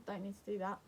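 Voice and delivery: ordinary delivery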